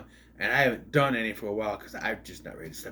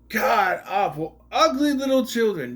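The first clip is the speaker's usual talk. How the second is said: exaggerated disgust at ugly animation; disgusted rant; 8 semitones higher, 4 dB louder than usual. Real, not acted. Ranting about the animation.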